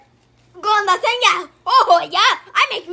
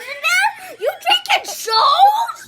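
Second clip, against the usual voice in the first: laughing; 8 semitones higher, 3 dB louder, slower than usual. Real, not acted. Laughing question.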